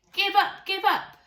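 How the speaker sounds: loud, high shouted demand; loud gang shout; robbers crying out. Acted. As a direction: yell it down at the pinned officer. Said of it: "the robbers cried".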